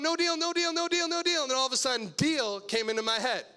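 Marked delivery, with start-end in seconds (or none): 0.0-1.4 panicked refusal. Retold or imitated. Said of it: "And I'm going".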